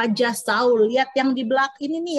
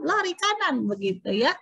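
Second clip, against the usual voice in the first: plain reading voice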